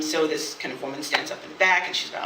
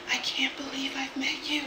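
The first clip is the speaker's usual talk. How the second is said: soft, intimate whisper into an ear; imitated whisper; 6 semitones higher, 9 dB quieter than usual. Date leaning in during a goodbye hug, whispering. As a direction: whisper it as a hushed confession. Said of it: "those whispers in the ear".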